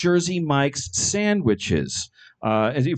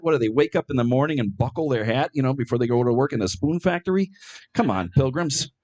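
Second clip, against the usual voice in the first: ordinary talk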